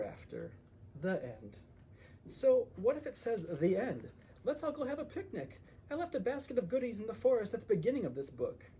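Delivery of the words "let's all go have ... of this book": bright, high, quick invite to a picnic; cheerful invitation. Acted. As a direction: call everyone eagerly to the picnic.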